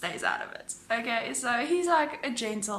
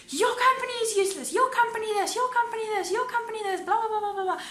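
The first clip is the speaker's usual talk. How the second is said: high, fast mock yelling; imitated angry rant; 11 semitones higher, faster than usual. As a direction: rattle off complaints in a raised, high-pitched imitation. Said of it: "he was yelling".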